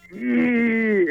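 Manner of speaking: high, tight-teeth "ee" squeal; cringing emoji noise; grimace emoji squeal. Retold or imitated. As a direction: squeal like a yikes emoji through bared teeth. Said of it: "the all teeth".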